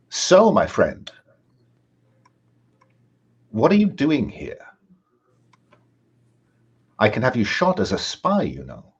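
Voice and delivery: put-on an interrogating German officer, lightly accented; mild German accent. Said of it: "a German speaking heavily accented English".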